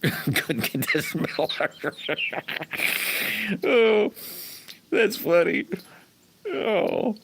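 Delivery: slow words squeezed out between laughs; can barely speak for laughing; laughing while talking. Real, not acted. Laughing at a viewer's smelly story, words slow through laughter.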